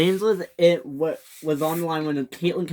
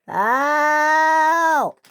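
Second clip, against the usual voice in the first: high, long, louder cry for help; 12 semitones higher, 6 dB louder than usual. Acted. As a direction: wail it across the line. James stranded, crying out.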